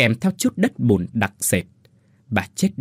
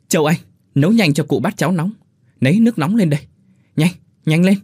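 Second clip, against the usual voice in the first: fast, higher and louder orders; urgent barking; 7 semitones higher, 5 dB louder, faster than usual. Acted. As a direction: rush the wife to fetch hot food. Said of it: "miệng gấp gáp quát". (quát gấp gáp)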